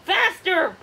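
loud, high urging call; urging shout. A narrator's reading. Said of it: "Puffin urged".

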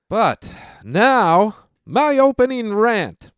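high, loud segment intro; announcer intro. Acted. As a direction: announce the next segment with flair. Introduces his opening rant.

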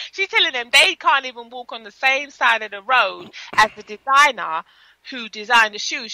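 ordinary delivery

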